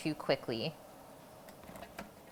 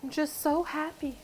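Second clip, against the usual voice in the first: mock happy tears; 9 semitones higher than usual. Teary from onions, joking.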